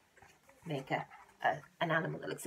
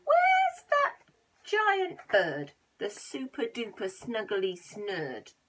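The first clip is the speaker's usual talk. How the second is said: eager shout; 5 dB louder, slower than usual. Acted. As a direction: call out for the bird. Robber demanding the bird.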